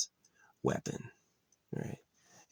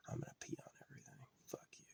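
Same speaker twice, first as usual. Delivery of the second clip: fast, high, whispered dog taunt; snarky dog voice; 20 dB quieter, much faster than usual. Pretend. A neighbor's dog taunting the camper, in a fast high whisper. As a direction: squeak it out quickly like a cocky little dog. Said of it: "he's still coming around going".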